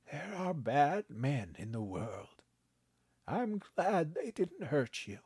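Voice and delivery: put-on a kindly old man, gently amused; old grandpa voice. A narrator's reading. Grandpa teasing his grandson, kindly old voice.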